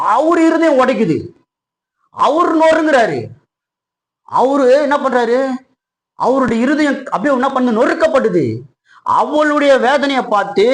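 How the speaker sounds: high, emphatic preacher's delivery; impassioned preaching. Acted. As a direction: drive each phrase up with urgent feeling. Preaches at full force.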